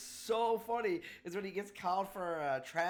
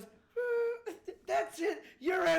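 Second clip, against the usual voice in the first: referee ejection; 12 semitones higher, 4 dB louder, much slower than usual. Retold or imitated. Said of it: "and he goes".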